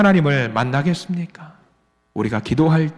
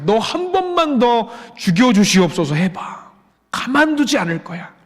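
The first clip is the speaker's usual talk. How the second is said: God threatening; 5 semitones higher than usual. Acted. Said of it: "그렇게 하나님께서".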